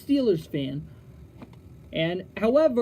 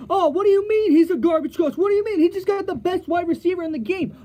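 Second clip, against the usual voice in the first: higher, louder mock outrage; indignant fan; 6 semitones higher, 3 dB louder, faster than usual. Host imitating a fan defending Gruden, mock indignant. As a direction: play the offended Raiders fan, loud and high. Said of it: "people are gonna turn around and say".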